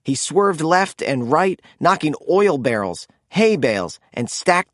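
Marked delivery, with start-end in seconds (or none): none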